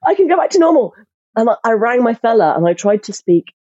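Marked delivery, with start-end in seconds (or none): 0.1-0.9 high-pitched mock celebration; delighted, high-pitched retold "yes!"; retold elated cry. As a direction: relive the relief in a high, jubilant voice. Retelling her overjoyed reaction to wrap.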